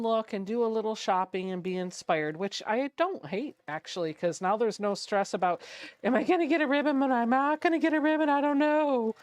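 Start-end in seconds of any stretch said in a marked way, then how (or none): 6.0-9.1 higher-pitched, comically panicked worrier; mock fretting. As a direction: fret in a rushed, exaggerated worry. Mimics fretting over winning a ribbon.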